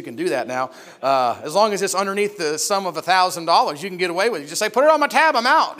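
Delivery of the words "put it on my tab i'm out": loud, high shout across the store; calling out. Acted. Customer to shopkeeper while leaving, calling out. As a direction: call it out on the way out.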